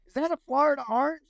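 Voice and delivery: high, goofy puzzled question; mock confusion. To his Ranger buddies, joking, high mock-puzzled voice.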